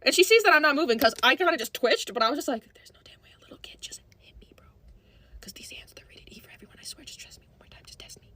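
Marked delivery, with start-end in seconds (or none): 2.7-8.3 barely audible whispered bravado; whispered threat. Retold or imitated. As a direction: act out whispering fighting talk to yourself. Retold muttered threat to herself.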